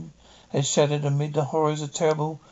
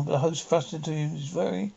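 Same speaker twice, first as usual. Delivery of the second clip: usual speaking voice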